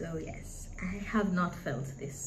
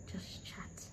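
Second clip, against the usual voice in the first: whispered; 14 dB quieter than usual. Real, not acted. Soft trailing aside.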